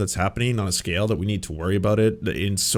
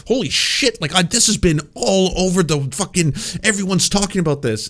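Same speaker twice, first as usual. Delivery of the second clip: mock alarm; 8 semitones higher, 3 dB louder than usual. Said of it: "Then, then you're like".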